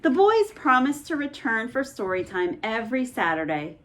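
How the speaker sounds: ordinary delivery, nothing marked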